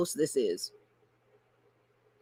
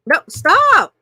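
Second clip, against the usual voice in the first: startled yelp; 9 semitones higher, 11 dB louder than usual. Real, not acted. Fighting her own screen.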